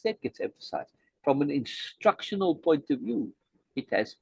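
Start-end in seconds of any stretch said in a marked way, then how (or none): 0.1-0.9 soft unvoiced whisper; whispered aside. Real, not acted. Teacher explaining capitalized words to students, whispered. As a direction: let it fall to a whisper for weight. Stressing a point while teaching.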